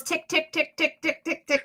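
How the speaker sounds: high, rapid ticks; high-pitched string of quick ticks; imitated ticking heartbeat. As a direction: tick along like a racing heart.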